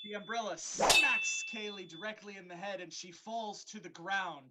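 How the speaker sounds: ordinary delivery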